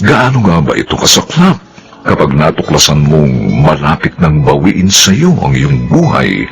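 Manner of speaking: ordinary delivery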